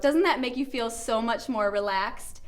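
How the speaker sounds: ordinary delivery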